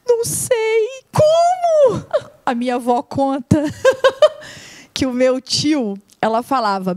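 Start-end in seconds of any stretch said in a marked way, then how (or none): 0.1-0.9 small, high voice of the little daughter; mild child voice. Retold or imitated. 1.1-2.0 high, loud laughing cry; real laugh. Real, not acted. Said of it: "laugh cry". 2.5-6.0 words spilling through laughter; real laughter; laughing while talking. Real, not acted. Telling funny story while laughing.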